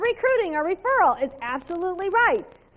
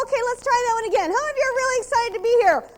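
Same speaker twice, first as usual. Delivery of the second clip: higher, louder and fast, pumping the room up; louder, higher, fast hostess hype to the party guests; excited crowd call; 8 semitones higher, 5 dB louder, faster than usual. Retold or imitated. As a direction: rev up the ladies like a hostess. Hostess asks the room again.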